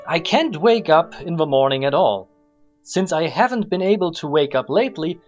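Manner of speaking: normal talking voice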